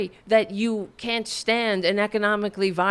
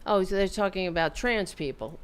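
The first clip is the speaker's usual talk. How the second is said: ordinary talk, nothing marked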